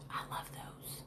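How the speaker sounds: a hushed, secret whisper; breathy whisper. Retold or imitated. To listeners about Rhonda's pills, whispered. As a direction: breathe it as a guilty confession. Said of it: "And I was like".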